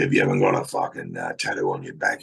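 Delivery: put-on low, lightly croaky old cop; a hint of a gravel-voiced old copper. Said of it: "He's that old croaky voice".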